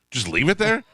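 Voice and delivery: incredulous. Retold or imitated. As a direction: repeat it back in disbelief. Incredulous echo of friend.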